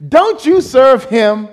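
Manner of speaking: slow, loud taunt from the enemy. Acted. Preacher voicing the devil taunting the congregation, slow loud sneer. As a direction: sneer it out slowly as the accuser.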